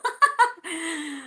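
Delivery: high chuckle at herself; light, high-pitched laugh in passing. Real, not acted. Streamer correcting herself to a viewer, laughing.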